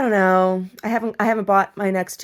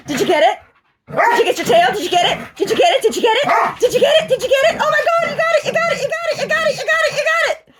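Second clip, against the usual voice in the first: much higher and louder, shouting encouragement building up; excited cheering; 10 dB louder than usual. Real, not acted. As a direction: whoop as the dog chases its tail. Cheering the dog chasing tail.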